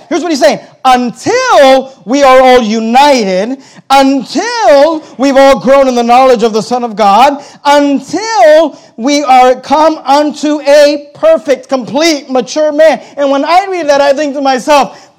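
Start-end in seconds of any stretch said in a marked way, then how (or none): 0.8-11.7 until stretched and stressed, slow; slow, deliberate until at the start of every line. Shown as a demonstration. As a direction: lean hard on each until. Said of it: "notice the emphasis".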